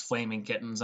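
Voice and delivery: ordinary delivery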